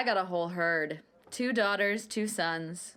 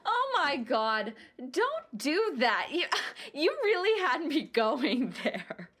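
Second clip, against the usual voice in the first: laughing relief; 8 semitones higher than usual. Acted. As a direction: scold playfully through the laughter. Laughing relief after being fooled.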